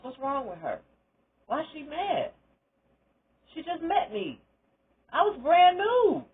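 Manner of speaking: bewildered, asking why; baffled plea. Retold or imitated. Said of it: "I asked my boss".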